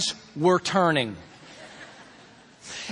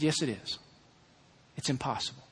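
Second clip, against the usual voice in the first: much softer, low and calm; soft calm answer; 9 semitones lower, 10 dB quieter than usual. Retold or imitated. Father quietly agreeing with his frustrated son. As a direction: let it land gently, almost a hush.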